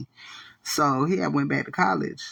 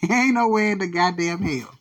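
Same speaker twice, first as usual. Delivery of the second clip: laughing; 5 semitones higher than usual. Real, not acted. Laughing emphatic repeat.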